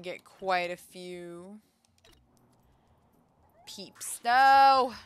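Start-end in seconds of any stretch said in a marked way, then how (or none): 4.2-4.9 sudden loud no; loud cry. Real, not acted. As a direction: shout it in dismay. Ambushed in game.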